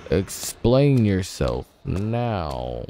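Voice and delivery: low, slow, soft stern demand; stern deep voice. Acted. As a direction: interrogate like a grave master. Stern interrogation of character.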